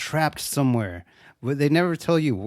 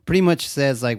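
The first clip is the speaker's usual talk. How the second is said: usual speaking voice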